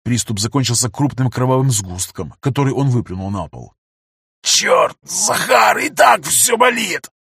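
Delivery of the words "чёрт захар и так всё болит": high, slow pained hiss; hissed through teeth. Acted. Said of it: "прошипел сквозь зубы".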